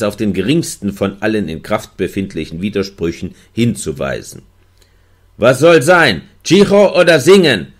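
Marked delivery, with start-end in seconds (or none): 5.4-7.7 lauter, höher gerufene Frage; Ruf. A narrator's reading. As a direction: ruf ihm die Frage zu. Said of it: "rief ich". (Gefangener fragt den Wärter, laut gerufen)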